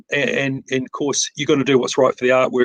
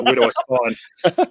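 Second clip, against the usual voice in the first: mock eager buyer; 10 semitones higher than usual. Eager buyer sold on machine.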